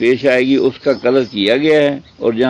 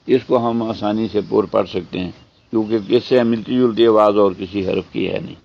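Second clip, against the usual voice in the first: usual speaking voice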